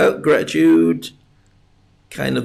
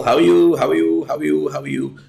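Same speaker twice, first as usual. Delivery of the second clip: fading repeated greeting. Shown as a demonstration. Host mimicking piano scales on how are you, fading. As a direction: play the phrase like piano keys going down. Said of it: "That's me playing piano".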